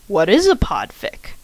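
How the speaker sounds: ordinary talk, nothing marked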